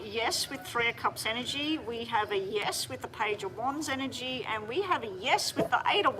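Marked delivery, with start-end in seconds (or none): none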